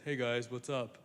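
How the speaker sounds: a hint of a low, casual dude; [name] answering the crowd, lightly voiced and casual; laid-back [name]. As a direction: greet them like nothing's wrong.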